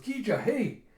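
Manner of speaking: high mock shout of alarm; alarmed call. Yona catching Gija as he topples, alarmed call. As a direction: call out urgently to someone falling. Calls out as she catches him.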